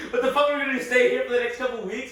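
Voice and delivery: high, loud frantic question; panicked outburst. Retold or imitated. Stranded narrator to sister, high loud panic. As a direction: blurt it in a laughing panic. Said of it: "I was just like".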